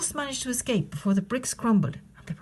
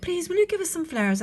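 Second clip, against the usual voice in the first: little wolves asking; 10 semitones higher than usual. Acted. Wolves ask flamingo.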